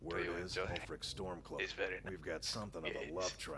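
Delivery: put-on low, soft seductive Spanish accent; Antonio Banderas type. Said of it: "I can't do the".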